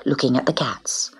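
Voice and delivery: ordinary delivery, nothing marked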